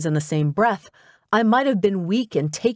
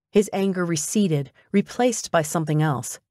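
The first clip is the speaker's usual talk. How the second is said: ordinary delivery, nothing marked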